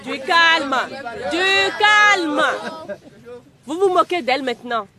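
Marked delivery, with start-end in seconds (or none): 0.0-0.8 firm raised call for calm. Acted. Teacher calms laughing class. 1.3-2.4 higher, louder insistent hush; louder call for calm. Acted.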